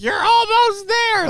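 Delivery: very high, loud, slow mock moan. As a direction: moan it like a porn scene. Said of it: "you had to word like".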